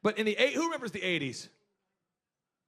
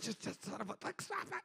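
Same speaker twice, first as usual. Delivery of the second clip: muttered curses, much softer; muttering curses; 13 dB quieter than usual. Retold or imitated. Muttering curses after the boss shames him. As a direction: mutter the curses under your breath. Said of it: "just muttering curse words".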